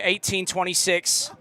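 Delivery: "Eighteen twenty-six" in a put-on English accent; mock English accent on "Eighteen twenty-six". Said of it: "let it rip".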